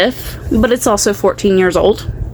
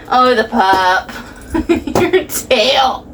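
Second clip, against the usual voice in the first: ordinary talk, nothing marked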